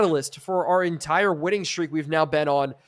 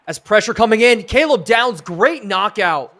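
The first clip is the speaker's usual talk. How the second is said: louder, raised call slowing on the last words; excited play call; 4 semitones higher, 6 dB louder than usual. Real, not acted. Streamer calling a knockout on the quarterback, excited. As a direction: call the big defensive play with a lift.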